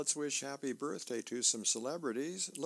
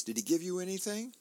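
higher, lightly voiced wife asking; a hint of a curious wife, pitched up; Blondie voiced; 8 semitones higher, 3 dB louder, faster than usual. Blondie asks Dagwood at home.

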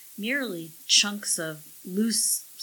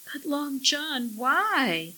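high, plaintive question; said numbly; 7 semitones higher, slower than usual. A narrator's reading. Millie asks the parrot who betrayed her, numbly. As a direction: ask it in hurt bewilderment. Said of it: "Millie said numbly".

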